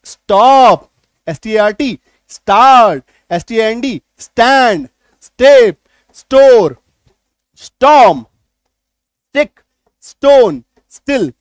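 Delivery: each word called out loud and clipped; loud teaching drill. Shown as a demonstration. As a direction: bark each word for the class to repeat. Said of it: "शब्द देखिए कैसे बनेगा".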